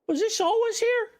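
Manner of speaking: very high, incredulous squeak; astonished question. Real, not acted. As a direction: shoot the pitch right up, astonished. Startled by an unnoticed part.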